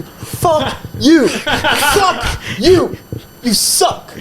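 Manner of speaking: high, laughing mock scream. Heckler screaming at the host across the bar, laughing mock yell. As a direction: scream it while pointing, half laughing.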